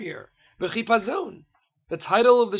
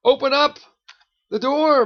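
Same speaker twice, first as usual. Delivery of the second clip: loud, higher call through a door; 7 semitones higher, 7 dB louder than usual. Acted. Lover outside to beloved within, calling out loudly. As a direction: call out insistently to be let in.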